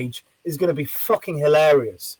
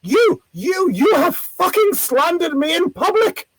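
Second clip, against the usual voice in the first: imitating an outraged lady, very high; misplaced indignation; 11 semitones higher, slower than usual. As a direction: splutter in high, hammed-up offence. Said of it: "this kind of misplaced indignation".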